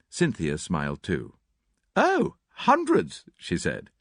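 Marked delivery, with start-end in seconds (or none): 2.0-3.2 high, slow, teasing; mock-wicked joke; playful. A narrator's reading. Cynthia joking.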